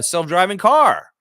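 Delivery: usual speaking voice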